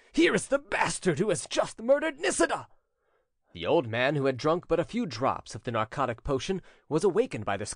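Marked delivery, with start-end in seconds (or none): 0.1-2.7 high-pitched outraged accusation; piercing cry. A narrator's reading. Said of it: "he cried in a piercing voice".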